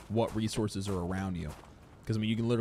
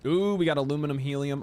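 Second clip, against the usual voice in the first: excited; 5 semitones higher, 6 dB louder than usual. Real, not acted. Finding resource.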